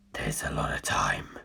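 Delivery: low, slow, grim whisper. Acted. Carr answering the narrator's absent question, in a grim low whisper. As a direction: breathe it out with grim realism. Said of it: "Carr's whispered response".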